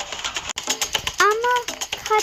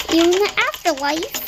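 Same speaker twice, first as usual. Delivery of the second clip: usual speaking voice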